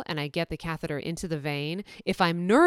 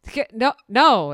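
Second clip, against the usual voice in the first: flustered denial; 7 semitones higher, 6 dB louder than usual. Retold or imitated. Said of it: "I'm like".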